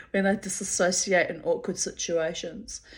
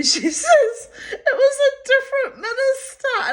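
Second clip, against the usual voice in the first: laughing; 8 dB louder than usual. Real, not acted. Laughing at the mix-up.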